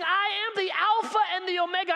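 ordinary delivery

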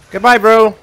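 loud high gloating farewell; loud taunt. Acted. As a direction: bellow goodbye like a taunt. Shooting a player off.

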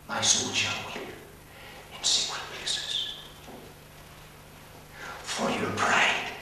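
breathy hushed whisper of grief; whispered lament. Acted. Preacher echoing Jeremiah's weeping, whispered lament. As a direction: whisper it like a secret sorrow.

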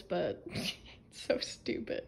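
unvoiced, slow giggling aside; soft laugh. Real, not acted. Vlogger to viewers about her setup, laughing softly. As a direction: breathe it out through a laugh.

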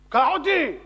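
sharp, high outburst; angry demand. Acted. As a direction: yell at the driver to get moving. Passenger demands to be driven.

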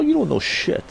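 telling the friend off, worked up; heated retort. Retold or imitated. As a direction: shut him down. Retold retort.

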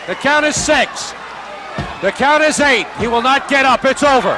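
roaring ringside commentary; excited shouted call. Real, not acted. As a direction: belt out the count like ringside. Counting out the fighter.

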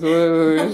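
loud rising whoosh sound. Pretend. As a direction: belt out a whoosh effect. Mimicking camera swoosh.

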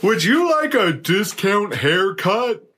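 high, slow, drawn-out huckster pitch; mock ad pitch. Host pitching a barber shop ad, hammed-up announcer. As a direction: sell it like a hammy commercial announcer.

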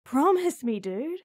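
urgent, pleading demand; urgent plea. Acted. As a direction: make him promise, loudly.

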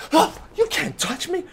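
high-pitched, loud mock indignation; squealed, put-on outrage; outraged girl. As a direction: imitate an offended girl, way up high.

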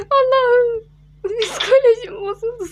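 high, loud crying-out; shaken whimper. Real, not acted. Scared player crying out after the jump scare. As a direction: wail in shaken fright.

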